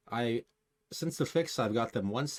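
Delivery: ordinary talk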